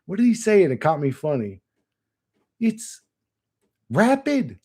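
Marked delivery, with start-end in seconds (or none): 2.6-4.6 high-pitched imitation of an Englishman; mock English accent. An Englishman remarking on the car, mock English accent. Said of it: "he said some English shit".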